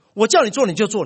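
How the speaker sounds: stern scolding. Retold or imitated. Father scolding son.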